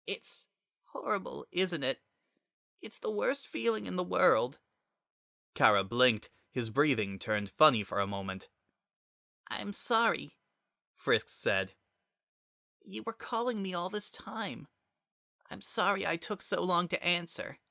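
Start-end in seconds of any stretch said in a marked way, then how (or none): 0.1-4.6 soft, high, tearful voice; choked with tears. A narrator's reading. Frisk choked with tears talking to Kara, soft and high. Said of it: "his voice choked with tears". 9.5-10.4 gentle apology. Acted. 12.8-17.6 soft, hushed, high; tender apology. Acted. Frisk softly apologizing to Kara, hushed and tender.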